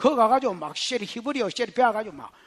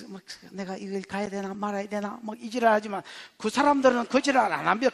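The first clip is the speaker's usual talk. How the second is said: ordinary delivery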